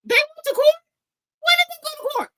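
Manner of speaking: very high, very loud outraged shriek; shrieking disbelief. Real, not acted. Hearing the court claim, she shrieks in outrage. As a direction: scream the question in disbelief.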